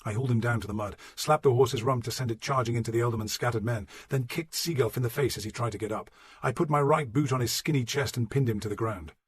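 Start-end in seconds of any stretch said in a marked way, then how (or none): none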